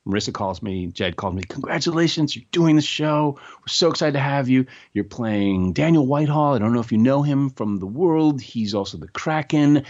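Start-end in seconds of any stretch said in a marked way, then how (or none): 1.4-9.8 imitating a delighted caller, higher-pitched; excited congratulations. Showrunners calling an actor with the job, excited. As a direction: deliver the good news brightly, pitched up. Acts agents' good-news phone call.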